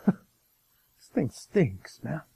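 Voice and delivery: slow, breathy whispered complaint; mock whisper. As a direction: whisper it slowly like a disgusted aside. Mock complaint of the prophets' sons.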